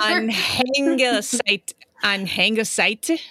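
slow, deliberate German; Anhängerseite drawn out with careful umlaut; German word. Shown as a demonstration. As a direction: say Anhängerseite slowly twice. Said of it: "It's perfect".

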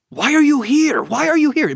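high-pitched, urgent imitation of an Italian woman; Italian lady. Italian lady grabs the speaker leaving church, high insistent imitation. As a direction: demand it high, in a rough go at her accent. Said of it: "I can't do the Italian accent".

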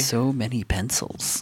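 slow breathless whisper. Real, not acted. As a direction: breathe it out in awe. Overwhelmed by pencils, whispering aside.

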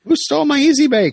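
put-on high whiny kid asking for toys; whiny child voice. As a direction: play a pouting little kid. Same child voice joke.

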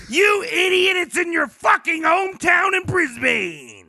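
high, loud mock yell; shouting. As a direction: yell it at a friend. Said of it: "I was like".

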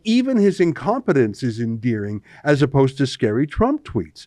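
ordinary delivery

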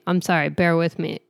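normal talking voice

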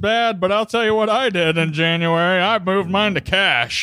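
booming tipsy uncle; drunk uncle voice. Pretend. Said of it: "the drunk uncle".

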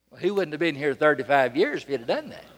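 ordinary delivery

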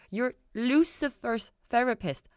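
slow, high, feeling the words out; slow wondering repeat; slow testing the words. A narrator's reading. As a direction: turn the phrase over slowly, tasting it. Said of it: "feeling the words out".

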